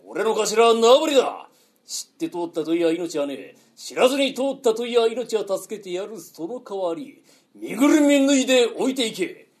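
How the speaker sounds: loud, rolling highwayman's challenge; bandit's challenge. Shown as a demonstration. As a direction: declaim it like a robber stepping out. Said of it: "バッと飛び出てこう声かけんだ".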